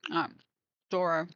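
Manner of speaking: talking through a mouthful of chocolate; words round a bite of food; chewing while talking. Real, not acted. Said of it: "I really shouldn't try fork while I'm eating".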